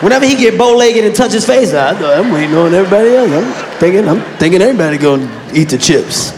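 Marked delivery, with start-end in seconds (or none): 1.7-6.3 lower, stammering guilty excuse; dumb excuse voice. Retold or imitated. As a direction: play dumb, fumbling the excuse.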